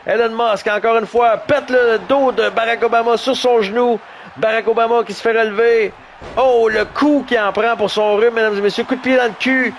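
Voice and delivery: high, excited wrestling commentary; live play-by-play. Acted. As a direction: call the fight like a ringside commentator.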